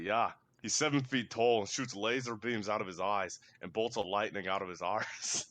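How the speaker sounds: put-on Scottish film quote, slowing at the end; Scottish accent. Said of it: "Scottish accent. Like, I'm really trying to think".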